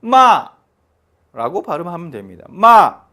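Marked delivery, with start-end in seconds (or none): 2.5-3.0 마 dropping sharply from high, loud; fourth tone. Shown as a demonstration. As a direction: snap 마 down from the top like a shout. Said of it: "소리치듯이".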